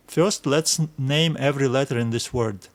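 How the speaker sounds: normal talking voice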